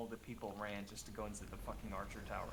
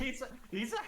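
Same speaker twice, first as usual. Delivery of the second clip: high voice breaking into laughter; laughing; 5 dB louder than usual. Real, not acted. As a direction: giggle through the words. Laughing at cute unit.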